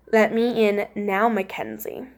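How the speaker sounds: low, slow, hushed imitation of an intruder; lightly voiced spooky visitor; creepy voice at door. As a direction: trail down softly on the name, eerily. Voicing creepy thing behind door.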